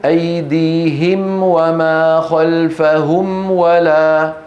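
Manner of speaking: slow measured tajweed recitation; Quran recitation demo. Shown as a demonstration. Teacher reciting the verse slowly for students. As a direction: recite slowly, showing the clear meem. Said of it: "نحو".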